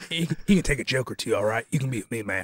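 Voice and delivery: lightly done gruff cop voice; a hint of a grizzled old cop; Gary Busey voice. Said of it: "I should just do the Gary Busey lines".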